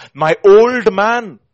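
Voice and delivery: slow, high, loud proclamation; emphatic preaching. Real, not acted. Preacher quoting Scripture on the old man, emphatic. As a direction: stretch it out at full voice.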